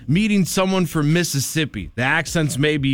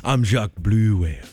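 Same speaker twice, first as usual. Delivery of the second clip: put-on low French accent; 8 semitones lower than usual. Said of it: "You are a French whale".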